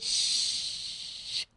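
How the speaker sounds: unvoiced hush of a daughter; a gentle whispered shh. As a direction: shush softly, a breathy hush. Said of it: "She said".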